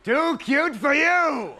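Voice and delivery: slow, loud, high host bellow; showman announcer. Retold or imitated. Ed McMahon hyping the kid dancers, slow loud announcer bellow. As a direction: sell the kids like a big-time host.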